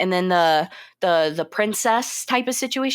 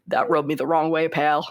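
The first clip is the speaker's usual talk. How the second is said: low-pitched cowboy impression; Sam Elliott; 5 semitones lower than usual. Co-host mimicking the actor's rant about the director, deep cowboy impression. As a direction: drop into a deep, folksy Western old-timer voice. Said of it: "let me do my Sam Elliott impression".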